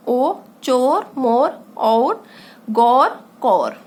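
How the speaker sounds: drawn-out vowels in चोर, मोर, और, गौर, कौर, each spoken very slowly; slow pronunciation demo. Shown as a demonstration. Teacher slowly sounds out words for pupils. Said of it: "उच्चारण देखो किस तरह से हो रहा है".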